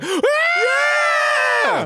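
very high, drawn-out mock cheer; cheering club crowd. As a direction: whoop like a whole crowd. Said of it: "the crowd's like".

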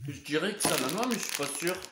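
speaking with food in mouth; chewing while talking. Real, not acted. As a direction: mouth partly full. Seen 0: Lips pursed, jaw working between words; no sausage itself visible.

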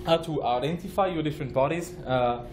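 ordinary delivery

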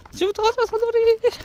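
high sing-song call to a dog; calling from far away. Real, not acted.

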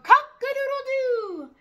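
high, long cartoon crow; crowing rooster. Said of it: "who crowed".

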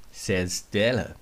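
plain reading voice

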